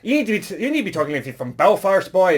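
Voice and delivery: loud, high, rapid mock Belfast accent; exaggerated Belfast accent, punched out loud. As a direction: ham up a Northern Irish accent at full volume. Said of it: "It does sound like an Irish stereotype".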